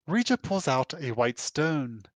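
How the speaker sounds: normal talking voice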